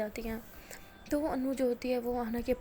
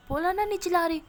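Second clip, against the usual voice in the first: loud, raised-pitch insistence; shouting; 7 semitones higher, 9 dB louder, faster than usual. A narrator's reading. Anu insisting to Ana she isn't yelling, shouting. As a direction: yell the denial in exasperation. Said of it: "चिल्ला रही हो तुम".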